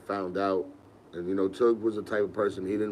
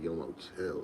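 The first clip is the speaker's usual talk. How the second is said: hushed shush; 4 semitones lower, 7 dB quieter than usual. Retold or imitated. Sneaking in late, parents asleep.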